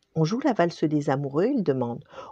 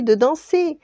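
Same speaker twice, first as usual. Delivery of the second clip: higher, louder repeat of danser; more excited; 11 semitones higher, 5 dB louder than usual. A narrator's reading. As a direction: push danser up with glee.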